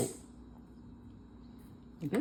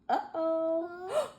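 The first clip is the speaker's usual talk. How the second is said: gasping uh-oh; 9 semitones higher, 3 dB quieter, much slower than usual. Real, not acted. Tower of blocks starts wobbling.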